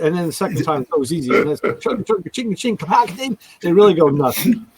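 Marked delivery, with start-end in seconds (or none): 1.6-2.8 high chugga-ching noises; imitated car noise. Caller repeating the noise for the hosts, high chugging imitation. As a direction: act out a caller doing engine sounds, pitched up. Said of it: "can you make the noise".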